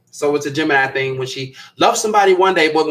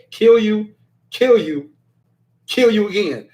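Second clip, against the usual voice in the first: raised, emphatic imitation of a threat; mock threat; 6 semitones higher, slower than usual. Host repeating what the viewer literally said, emphatic mock threat. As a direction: punch out each threat separately, playfully. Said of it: "she literally said".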